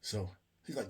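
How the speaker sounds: ordinary talk, nothing marked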